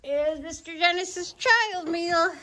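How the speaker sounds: slow, high sing-song serving; high, drawn-out mock-sweet announcement; babying tease. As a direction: present it as if to a small child. Serving the teasing child's meal.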